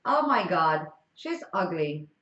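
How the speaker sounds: ordinary talk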